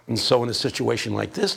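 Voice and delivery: ordinary talk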